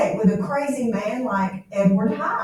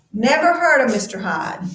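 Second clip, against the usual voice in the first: teacher reading the line to pupils; read-aloud paraphrase; 3 semitones higher, 4 dB louder than usual. Shown as a demonstration. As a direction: read to the class, a touch louder. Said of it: "He's reading right now".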